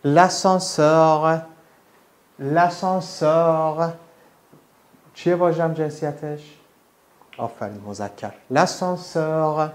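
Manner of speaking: L'ascenseur stretched very slowly, ending in a French r; L'ascenseur spoken very slowly; slow French model. Shown as a demonstration. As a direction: sound out L'ascenseur slowly for copying.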